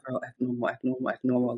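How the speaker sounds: soft, repeated under-the-breath coaching; hushed self-reminder. Retold or imitated. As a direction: quietly talk yourself back to normal. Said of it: "I used to be like".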